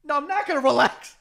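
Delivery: high, loud laughing refusal; mock outrage. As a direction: refuse to calm down. Victim refusing calm.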